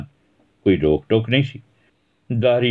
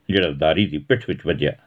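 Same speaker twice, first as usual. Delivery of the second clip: plain reading voice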